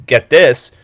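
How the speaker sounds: normal talking voice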